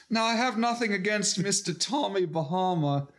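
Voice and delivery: hammed-up British accent; Russell Brand. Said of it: "just the accent makes everything funny".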